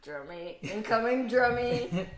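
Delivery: ordinary talk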